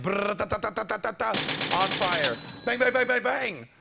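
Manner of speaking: mock gunfire. Host mimicking gunfire for a rapid-fire show. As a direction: spit out imitation gunfire. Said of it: "I'm like".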